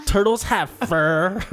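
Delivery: drawn-out, slow Valley Girl imitation. Mimicking co-host's reaction, mock Valley Girl. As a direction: stretch it out like an incredulous Valley Girl. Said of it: "best Valley Girl reaction".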